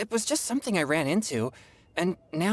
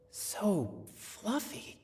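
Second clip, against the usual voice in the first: soft, awed murmur; 4 semitones higher, 9 dB quieter, much slower than usual. Acted. Admiring ears and tail.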